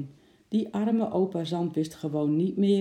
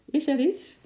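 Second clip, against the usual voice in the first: high, louder, rising question; cheerful call; 10 semitones higher, 5 dB louder than usual. A narrator's reading. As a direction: call it out cheerily, lifting the pitch. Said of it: "riep hij vrolijk".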